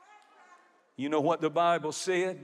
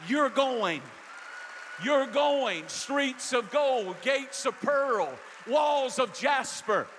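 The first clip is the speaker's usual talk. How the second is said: preaching at full force; 7 semitones higher than usual. Acted. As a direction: carry the church toward heaven in full preaching voice. Preacher voicing dying Jacob.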